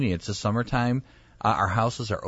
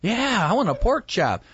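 hammed-up eager yes, high and loud; drawn-out, high-pitched mock enthusiasm; eager guest; 10 semitones higher, 5 dB louder than usual. Guest offered a pork chop at the grill, eager.

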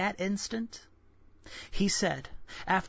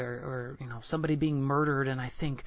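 usual speaking voice

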